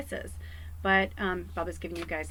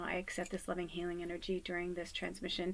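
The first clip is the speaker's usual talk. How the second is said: much softer, gentle spoken blessing; soft affirmation; 2 semitones lower, 10 dB quieter than usual. Shown as a demonstration. Giving viewers a blessing to repeat, soft and gentle. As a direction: say it gently, like a prayer. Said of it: "all you have to say is".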